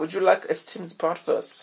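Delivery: put-on slow, stilted German accent. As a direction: offer it in a mock-German voice. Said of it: "say in an accent".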